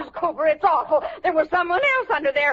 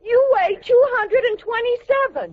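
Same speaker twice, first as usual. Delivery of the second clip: alarmed maid reading the scale; shocked blurt; 8 semitones higher than usual. Acted.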